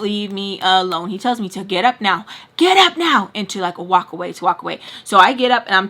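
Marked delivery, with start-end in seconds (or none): 2.6-3.3 louder, higher mock command; punchy, raised-voice order; retold barked order. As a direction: imitate an urgent order to get up. Repeats retold order much more forcefully.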